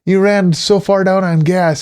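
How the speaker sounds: high, loud disbelieving shout; exasperated yell. Retold or imitated. Exasperated at friend low on gas.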